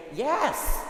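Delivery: high, bright, eager yes. Pretend. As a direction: answer yes straight away, eagerly. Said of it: "I would say".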